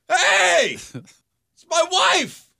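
high, booming indignant holler; drawn-out, full-volume protest; yelling. Retold or imitated. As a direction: yell it down the hallway, stretching the words. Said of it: "my first instinct is to yell".

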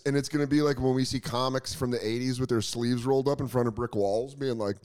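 usual speaking voice

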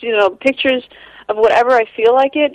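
ordinary talk, nothing marked

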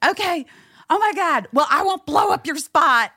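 high, giddy superfan voice; imitated excited fan. Fan promising secrecy before driving off, high giddy imitation. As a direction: squeal it in mock excitement. Retold fan's excited farewell.

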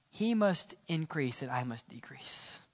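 a soft, breathless whisper of surrender; hushed whisper. Acted. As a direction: whisper it, very simply. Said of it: "very simply".

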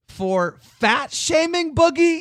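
hammed-up gasp of an accusation; mock shock. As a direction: feign scandalized outrage at Boogie. Teasing accusation of fat shaming.

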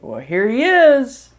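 high, loud, drawn-out mock presentation; announcing the baby. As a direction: sing out a proud reveal, imitating staff. Acted hospital staff presenting newborn.

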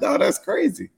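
squeaky no way; high amazed disbelief; high surprised exclaim. Retold or imitated. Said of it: "I was like".

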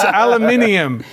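playful put-on British accent. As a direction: say "aluminium" like a Brit. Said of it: "I said it in a British accent".

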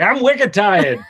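put-on a Boston kid whining; Boston accent. Dad joking on a podcast, mock Boston toddler accent. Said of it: "Boston toddlers are like".

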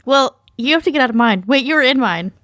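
ordinary delivery

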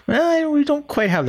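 high prim mock voice; prissy put-on voice. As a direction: act scandalized and proper. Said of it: "And I'm like".

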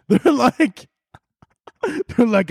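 high voice breaking into laughter; laughing. Real, not acted. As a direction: try to go on while cracking up. Laughing at own acted line.

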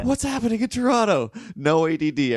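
high, amped-up exclamation; hyped-up Torontonian. Acted. Comic as Torontonian with no ADD, hyped-up shout. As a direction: shoot the pitch way up, manic big-city energy.

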